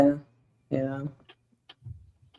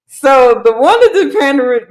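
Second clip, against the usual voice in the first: laughing; 3 semitones higher, 7 dB louder than usual. Real, not acted. Cracking up at co-host's claim.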